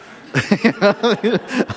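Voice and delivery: high, loud laughing; real laughter. Real, not acted. Teacher amused by his relaxation Olympics, real laughter. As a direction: break up laughing at your own joke.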